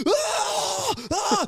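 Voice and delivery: very high mock scream of agony; high-pitched acted shriek of pain; imitated pain scream. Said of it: "he just starts screaming in, like, horrible pain".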